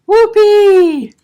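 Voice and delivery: very high, very loud whoop; excited exclaim. Acted. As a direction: shriek it with glee. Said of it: "exclaims Pam".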